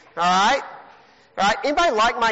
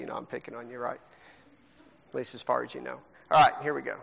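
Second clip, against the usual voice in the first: normal talking voice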